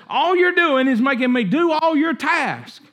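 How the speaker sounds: a hammed-up sulky protest; student snapping at his teacher; mock angry outburst. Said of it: "he says".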